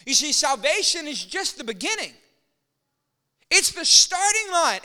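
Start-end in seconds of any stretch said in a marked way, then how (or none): 3.5-4.8 raised, slow preacher emphasis; emphatic. Real, not acted. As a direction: land it loud and slow.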